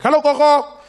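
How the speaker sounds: put-on high, loud little-boy hello; child greeting. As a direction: call out brightly to grandma.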